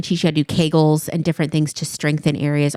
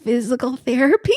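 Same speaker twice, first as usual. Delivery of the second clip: awkward blurt; 6 semitones higher than usual. Retold or imitated. Awkward answer to mother-in-law.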